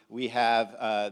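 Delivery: normal talking voice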